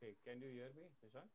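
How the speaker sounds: faint, distant mic audio; barely audible. Real, not acted. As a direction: voice almost lost, mic too far. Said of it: "It's very low".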